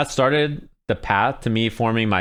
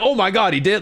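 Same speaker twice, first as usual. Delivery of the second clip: high, louder excited gasp; 9 semitones higher, 3 dB louder than usual. Retold or imitated. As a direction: react like you can't believe it. Steve reacting to the match.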